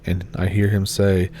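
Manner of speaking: plain reading voice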